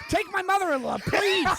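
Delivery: high, loud Catskills comic delivery; old borscht belt comic. Retold or imitated. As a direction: land the one-liner like a Catskills comic. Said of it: "You became a Catskills comic".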